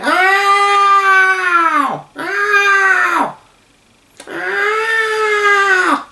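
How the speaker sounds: shrill, high, loud cries of pain; three rising howls of pain; pained screams. Acted. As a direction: scream Ow like a hurt child. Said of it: "screamed and squealed and shouted in pain".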